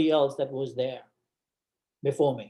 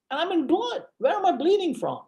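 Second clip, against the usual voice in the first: high-pitched retold panic; acted panic; 14 semitones higher than usual. Patient lying in blood, acted high panicked pleading. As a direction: re-enact frantic alarm in a high voice.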